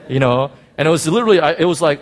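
ordinary delivery

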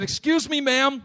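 high-pitched mock-timid call; tentative polite approach. Preacher re-enacting his approach to the injured woman, high and tentative.

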